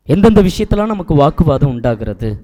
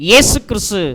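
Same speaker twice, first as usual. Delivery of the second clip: normal talking voice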